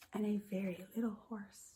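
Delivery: hushed whisper for the little horse. Acted. Teacher whispering the little horse to young children. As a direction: make it tiny with a whisper.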